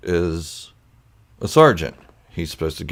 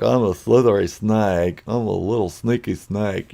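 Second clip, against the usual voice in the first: drawn-out s like a snake; 3 semitones higher than usual. Retold or imitated. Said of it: "kinda like you'd imagine a snake to talk".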